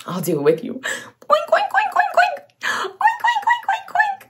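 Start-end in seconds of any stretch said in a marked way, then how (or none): 0.7-2.4 very high squeaky piglet oinks. Acted. Oinking with the child like a piglet. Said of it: "Can you oink like a piglet?". 2.6-4.2 very high oinks with a laugh; laughing piglet oinks. Acted. Oinking playfully with the child, laughing. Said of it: "Can you oink like a piglet?".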